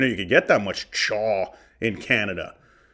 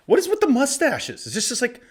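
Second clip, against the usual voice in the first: exasperated question; 11 semitones higher than usual. Real, not acted. Exasperated at all mustaches.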